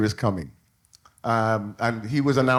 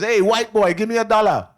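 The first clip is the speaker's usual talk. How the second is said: higher, louder cheeky street call, laughing; Jamaican beggar kid; 6 semitones higher, 5 dB louder than usual. Retold or imitated. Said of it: "a little beggar says".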